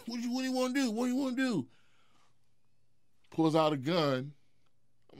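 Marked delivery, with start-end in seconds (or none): 0.1-1.7 high-pitched mocking imitation of a challenger; Cat Williams. Comedian retelling his rival's challenge in a standoff, mocking high voice. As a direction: squeal it in a mock falsetto challenge.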